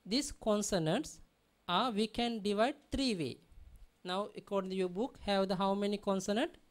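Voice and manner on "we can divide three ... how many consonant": ordinary talk, nothing marked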